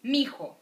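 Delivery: Mijo as one word, mi-jo, not mi hijo; contracted form. Shown as a demonstration. Teacher to students, modeling the contraction Mijo. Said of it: "but mijo".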